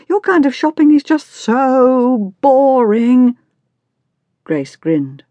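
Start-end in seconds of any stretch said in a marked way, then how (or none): 0.1-3.4 high, slow, drawn-out whine; bored teenage complaint. A narrator's reading. Daughter condemns her mother's kind of shopping, bored and whining. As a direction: stretch out the complaint, higher and exasperated.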